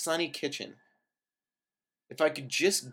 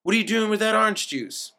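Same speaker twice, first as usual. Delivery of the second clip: angry; 7 semitones higher, 5 dB louder than usual. A narrator's reading. Al accuses guest angrily.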